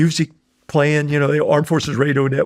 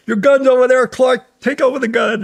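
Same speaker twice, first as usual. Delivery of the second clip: shouted order; 10 semitones higher, 3 dB louder than usual. Retold or imitated. Gunny orders him mid-firefight.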